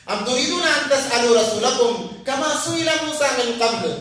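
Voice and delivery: chanted Arabic recitation, melodic and measured; Quran recitation. Acted. Preacher cites the verse to the congregation, recited in chant.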